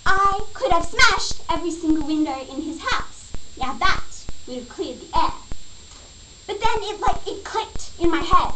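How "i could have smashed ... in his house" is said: ordinary delivery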